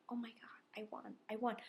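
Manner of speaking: soft, breathless whisper of disbelief; awed whisper. Retold or imitated. Player sitting courtside after winning, awed whisper. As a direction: whisper it to yourself, stunned. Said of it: "sitting there going".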